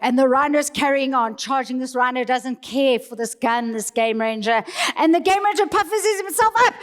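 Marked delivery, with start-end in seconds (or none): none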